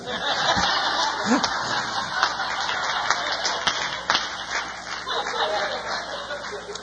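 high breathy laughter after the punchline; long delighted laugh. Real, not acted. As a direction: laugh hard at his own joke.

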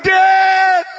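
high, drawn-out shout; calling out. Retold or imitated. Son calling his dad at the pulpit, high shout. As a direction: call across the church, high and long.

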